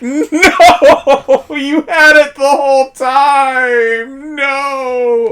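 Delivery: high, slow laughing disbelief; disbelieving laugh. Retold or imitated. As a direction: laugh it out, can't believe it. Opponent stunned, laughing in disbelief.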